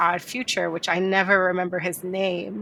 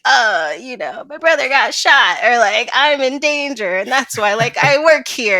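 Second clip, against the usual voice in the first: mock melodrama; 5 semitones higher, 8 dB louder than usual.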